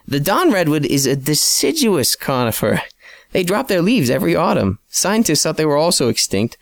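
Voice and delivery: lightly voiced scholarly sidekick; Codit, knowing colleague. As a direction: lay out the botany like a patient expert. Narrator voices Codit explaining.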